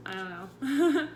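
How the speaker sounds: giggling through the words; laughing. Real, not acted. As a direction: laugh it off, unsure. Laughs over her own words.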